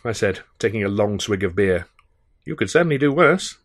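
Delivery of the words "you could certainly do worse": a hint of a dry, formal butler; measured and proper, deadpan; gentle touch of a polite manservant; lightly voiced English butler. Jobson the butler speaks.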